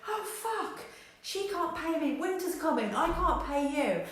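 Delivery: shrieking high mock panic; second provider catching the panic; freaking out. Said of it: "everyone's freaking out".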